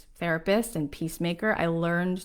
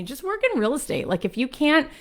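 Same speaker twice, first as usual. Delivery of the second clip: higher imitated voice urging practicality; advising friend; 3 semitones higher than usual. Friend advising her to work in real estate, higher and insistent. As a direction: mimic a pushy friend, pitched up.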